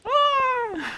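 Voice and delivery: sharp, very high cartoon grunt; high game death cry. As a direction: yelp it up high like a game unit. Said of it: "I can recreate it".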